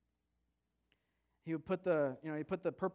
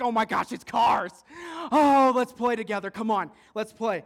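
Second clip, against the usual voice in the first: put-on loud, high, gushing child; excited shouting; 7 semitones higher, 17 dB louder than usual. Cousin discovering the Matchbox cars, excited shouting. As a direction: squeal and urge him to join in.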